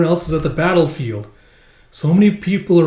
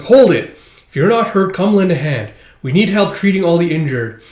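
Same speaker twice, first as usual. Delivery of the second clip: firm loud order; 5 semitones higher, 5 dB louder than usual. Acted. Boy leaves, girl stops him.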